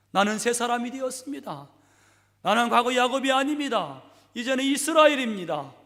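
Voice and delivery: high, loud declaration; proclaiming. Acted. Jacob declaring to God he is a new man, loud. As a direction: proclaim it triumphantly.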